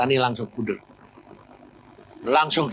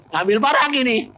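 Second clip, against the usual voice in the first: usual speaking voice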